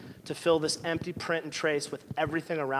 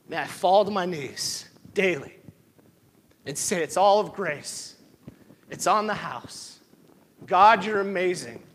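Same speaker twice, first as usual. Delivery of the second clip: preaching at full force; 3 semitones higher, 7 dB louder than usual. Acted. Impassioned prayer of grace.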